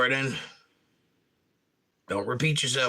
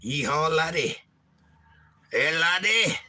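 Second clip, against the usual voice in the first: put-on Scottish accent; 4 semitones higher than usual. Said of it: "'cause I'm clearly a Scot".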